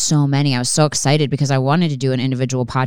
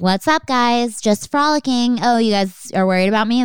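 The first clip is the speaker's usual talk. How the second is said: higher, breezy voice for the runaway dog; carefree dog voice; 8 semitones higher than usual. Retold or imitated. Voicing the escaped dog to worried owners, carefree and high.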